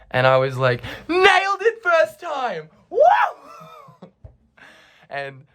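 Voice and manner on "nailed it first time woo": high, drawn-out victory cheer; hammed-up squealed celebration; mock triumph. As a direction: crow it in falsetto like a champion. Retold boast after success.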